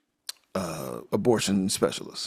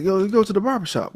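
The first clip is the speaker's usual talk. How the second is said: higher, punchy joke delivery; higher mock Harvey; 9 semitones higher than usual. Harvey giving Tibbs a savage joke answer, higher and punchy. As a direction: pitch it up and deliver the punchline with glee. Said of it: "He said".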